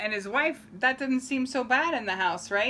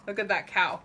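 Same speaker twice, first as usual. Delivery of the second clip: usual speaking voice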